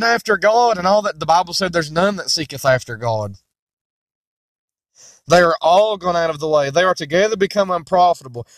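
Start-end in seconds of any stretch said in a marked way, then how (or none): 1.7-6.7 grave verse. Acted. Preacher answering the seeker objection, grave. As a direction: drop the verse like a verdict. Quotes Bible verdict.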